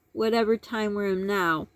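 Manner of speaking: normal talking voice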